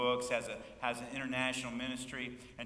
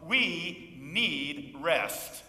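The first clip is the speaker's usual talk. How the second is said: slow refrain; 5 dB louder, much slower than usual. Acted. Repeated refrain, drawn out.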